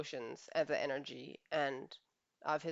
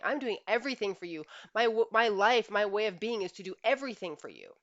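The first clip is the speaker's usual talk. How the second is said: raised, louder insistence of a hurt husband; his instinct speaking; 4 semitones higher, 6 dB louder than usual. Retold or imitated. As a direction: voice the husband's inner protest, pushed louder. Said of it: "his instinct is saying".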